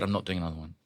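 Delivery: soft, firm repeat; muttered refusal. Retold or imitated. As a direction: refuse quietly. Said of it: "I said".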